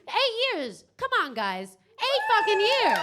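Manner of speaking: high, loud call for applause; rally the room to cheer; shouting it out to get the crowd clapping; hyping the crowd. Real, not acted.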